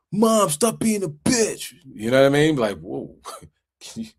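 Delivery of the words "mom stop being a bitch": put-on high, slow, whiny teen talking back to mom; a hammed-up teenager mouthing off; mock bratty teen. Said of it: "they act different with their parents".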